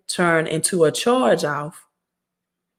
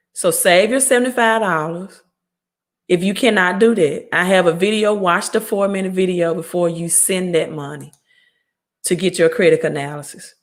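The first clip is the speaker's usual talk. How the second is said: normal talking voice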